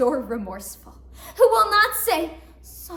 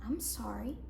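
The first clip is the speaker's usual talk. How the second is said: woman to herself, saying what she says most, meekly; soft, low little sorry; meek apology; 5 semitones lower, 10 dB quieter than usual. Acted. Woman showing what women say most, meekly apologetic.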